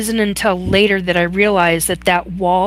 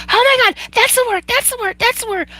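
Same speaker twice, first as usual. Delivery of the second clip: much higher, fast, thrilled shriek of recognition; excited shriek; faster than usual. Retold or imitated. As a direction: squeal it out like you finally found the word. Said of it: "I started screaming".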